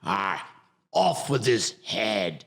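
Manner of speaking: hammed-up English pirate accent; growl-and-"ah" pirate with a fake English accent, drawn out; bad English accent. Shown as a demonstration. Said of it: "that bad English accent".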